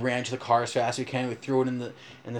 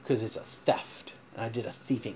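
hushed confession; 5 semitones lower, 10 dB quieter than usual. Real, not acted. Confessing a theft.

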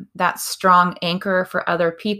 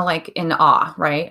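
ordinary talk, nothing marked

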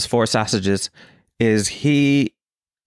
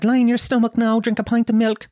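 high-pitched mock mother voice; nagging mother; 10 semitones higher than usual. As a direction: imitate an Irish mam urging, pitched right up. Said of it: "your mother would be always like".

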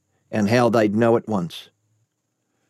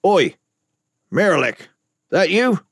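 calling out; 7 semitones higher than usual. Acted. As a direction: call out across the street. Kaveh calls out in the dark.

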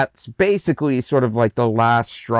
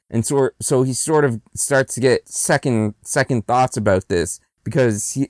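ordinary talk

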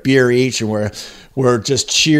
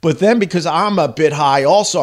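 ordinary talk

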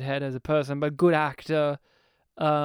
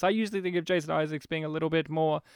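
ordinary talk, nothing marked

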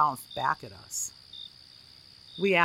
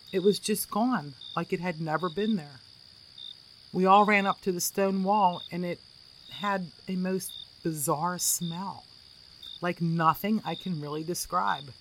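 usual speaking voice

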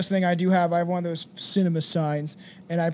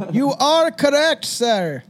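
loud, slow, higher host announcement; game show host; 8 semitones higher, 5 dB louder, much slower than usual. Shown as a demonstration. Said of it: "repeats answer, game show style".

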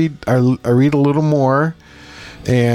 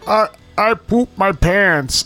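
higher, slow embarrassed confession; sheepish old man; 7 semitones higher, slower than usual. Retold or imitated. Imitating the patient admitting he pooped. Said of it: "He goes".

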